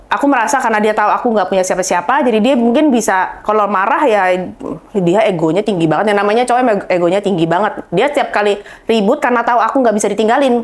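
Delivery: ordinary talk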